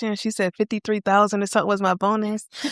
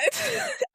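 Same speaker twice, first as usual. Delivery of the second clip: nervous laugh; 8 dB quieter than usual. Real, not acted. Laughing at own panic.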